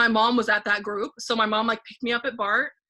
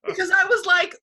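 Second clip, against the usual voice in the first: ordinary talk, nothing marked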